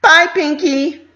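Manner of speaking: high, loud, slow little filly's goodbye. A narrator's reading. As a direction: call out a drawn-out bye like a young filly.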